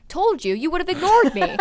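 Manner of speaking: high, loud mock outrage; incredulous comeback. Roommate answering friend's reproach, loudly incredulous. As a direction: fire back in playful disbelief.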